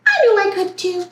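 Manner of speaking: put-on high, quick young bird voice; small, eager character; little bird Mel. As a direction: chirp it fast and proud. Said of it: "said Mel".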